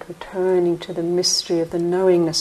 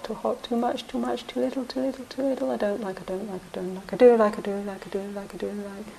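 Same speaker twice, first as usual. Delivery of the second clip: quiet, higher, muttered singsong of a restless mind; mimicking the mind's chatter; 3 semitones higher, 6 dB quieter than usual. Teacher voices the guru muttering the mind's chatter, a soft, higher singsong. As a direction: murmur the endless likes and dislikes under your breath. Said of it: "He's just going".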